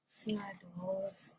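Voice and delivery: breathy whispered guess; whispered answer. Real, not acted. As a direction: whisper the word Snowballs. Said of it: "reading answer, whispered".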